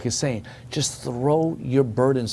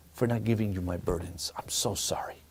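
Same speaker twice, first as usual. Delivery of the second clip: softer, lower apology sinking to a whisper; retold tearful prayer; 4 semitones lower, 8 dB quieter than usual. Rabbi pleading to God for forgiveness, tearful and hushed. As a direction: let the remorse fade into a near whisper. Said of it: "he said with tears".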